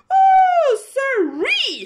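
very high, loud shrieking oh sirree; panicked cry. Acted. Cow shrieking as the plane wobbles, panicked.

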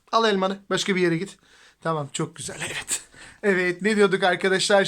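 ordinary talk, nothing marked

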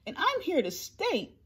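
high, haughty kangaroo scolding; scoff, then tell off the elephant sharply; snapping. A narrator's reading. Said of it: "snapped the big kangaroo".

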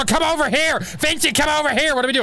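high, urgent call across the room; mock frantic call. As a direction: yell for your partner in a high pitch. Calling to Vincent in game.

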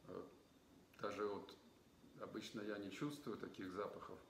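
glass rim before mouth; talking while sniffing wine; speaking into wine glass. Real, not acted.

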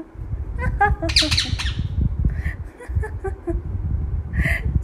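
breathless laughing fit; can't stop laughing; wheezing laughter; airy real laughter. Real, not acted. Laughing at parrot.

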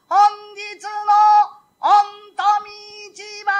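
high, loud drawn-out announcement; ringing lottery call; announcer's call. Acted. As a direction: call the winning number out across the temple. Lottery caller announces the number.

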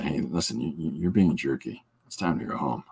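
low, soft tough-guy warning; half-hearted bouncer telling someone off. Retold or imitated. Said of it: "a bouncer voice".

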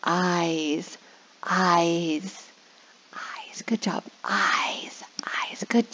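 dropping to a whisper for suspense. Shown as a demonstration. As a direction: draw the kids in with a secret hush. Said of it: "And then I'll say".

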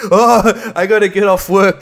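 high, loud hurried parent, laughing; laughing mock urgency. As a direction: fake panic while giggling. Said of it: "Why am I laughing about this".

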